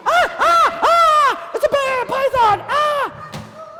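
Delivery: very high, loud comic shrieks; panicked monkeys. Acted. Monkeys seeing a bear and python, panicked screaming. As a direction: scream in mock terror.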